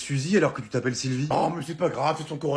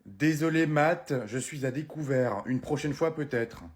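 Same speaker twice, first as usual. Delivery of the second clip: usual speaking voice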